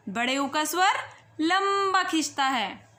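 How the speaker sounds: normal talking voice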